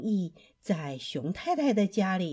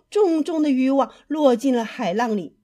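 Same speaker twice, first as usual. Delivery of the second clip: ordinary delivery, nothing marked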